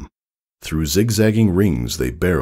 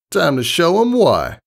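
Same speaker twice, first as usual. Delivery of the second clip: high, cocky tomboy voice; high brash tomboy pony; brash pegasus; 13 semitones higher, 4 dB louder than usual. Acted. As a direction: play the confident young flyer explaining. Rainbow Dash voiced in story.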